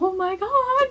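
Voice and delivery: high, loud "Oh my God" in sudden wonder; retold shock at the powder; amazed gasp. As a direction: squeal it like you just saw it. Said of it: "I'm just like".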